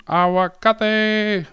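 high-pitched, stretched call, hawking fruit down the street; megaphone vendor's yell. Retold or imitated. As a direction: imitate a street seller's high, drawn-out cry. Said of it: "he would yell on the megaphone".

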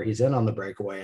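ordinary talk

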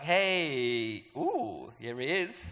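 heaven's voice. Acted. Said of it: "heaven's language is like".

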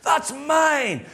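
put-on high-pitched little child's protest; mock child's whine, pitched right up; possessive three-year-old. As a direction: claim it like an indignant toddler. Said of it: "Grace walks over, says".

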